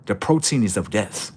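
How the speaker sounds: usual speaking voice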